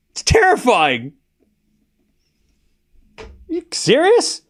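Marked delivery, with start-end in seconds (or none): 3.2-4.4 high, incredulous are you serious; can't believe chat said that; pitched-up outraged disbelief. Real, not acted. Arguing witches are villains with chat.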